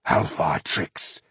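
low, slow whispered spit; spat out. Acted. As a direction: spit the words in disgust. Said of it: "Ironheart spat out".

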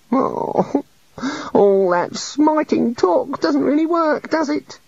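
gloomily. A narrator's reading. The god admits his failure to the wizards, gloomily. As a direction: sigh it out like a sulking little god. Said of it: "he said gloomily".

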